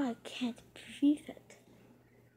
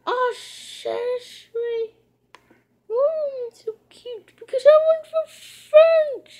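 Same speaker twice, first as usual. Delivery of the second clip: excited squeal; 10 semitones higher, 8 dB louder than usual. Real, not acted. Reacting to the game.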